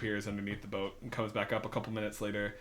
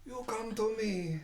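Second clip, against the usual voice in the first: high, slowed-down mob godfather voice; Marlon Brando; 10 semitones higher, slower than usual. Acted. Game master voices a character as a godfather, hammed-up Brando. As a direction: play a slow, high-pitched movie don. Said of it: "It's Marlon Brando".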